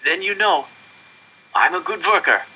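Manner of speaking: high, cracking plea. A narrator's reading. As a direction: push through a breaking voice. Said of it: "my voice cracking".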